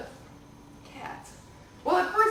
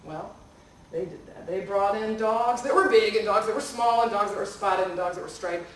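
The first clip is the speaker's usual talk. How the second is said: plain reading voice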